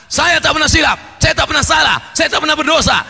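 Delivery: high, shouted mock declarations; mock boasting. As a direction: shout each denial like a boast. Mimics people boasting of sinlessness. (seruan pongah bernada tinggi)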